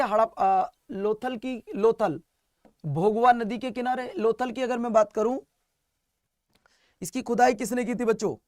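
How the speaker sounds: normal talking voice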